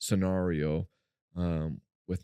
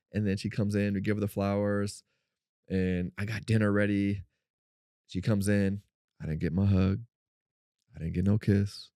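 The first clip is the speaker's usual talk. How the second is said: normal talking voice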